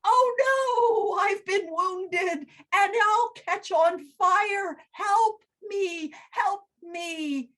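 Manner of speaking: burning tree screaming for help; shrieking tree. Acted. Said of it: "The tree shrieked".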